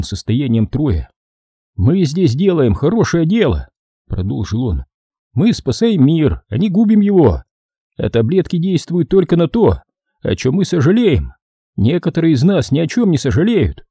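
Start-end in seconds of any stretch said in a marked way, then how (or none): 1.8-3.7 higher-pitched character voice; earnest doctor. A narrator's reading. Doctor justifies the work to Troy, earnest higher voice. Doctor's lines in narrated dialogue. 5.3-13.8 markedly higher, insistent character voice; earnest doctor. A narrator's reading. Doctor explains the saving of the world, earnest high voice. Doctor's lines in narrated dialogue.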